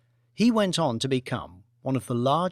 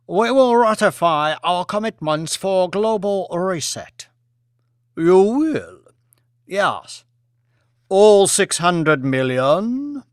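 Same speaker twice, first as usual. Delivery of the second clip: higher, louder dialogue; voiced negotiation; 5 semitones higher, 7 dB louder, slower than usual. Lee and Lord Martin haggle over debt, higher and louder. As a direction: play it as a tense deal.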